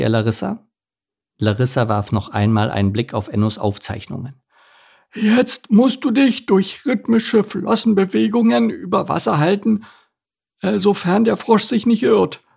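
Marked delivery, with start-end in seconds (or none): 5.1-12.4 a turtle reading advice she doubts; high, hesitant turtle voice; unsure turtle. Acted. Turtle reads instructions.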